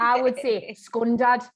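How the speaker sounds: scundad with a short Geordie ending, said slowly. Shown as a demonstration. Geordie host showing her own version, scundad. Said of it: "which is awful".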